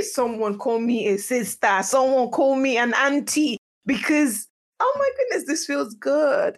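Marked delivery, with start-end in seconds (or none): 4.8-5.5 a high, thrilled oh my goodness; delighted squeal. Real, not acted. Overjoyed at her download count. 5.5-6.6 slow, high, drawn-out this feels good; savoring joy. Real, not acted. Savoring her small success.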